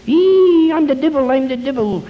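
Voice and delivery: very high, long squeal falling off into the words; mock devil. Wife jumps out at drunk husband, hammed-up devil shriek. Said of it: "she jumps out in front of him".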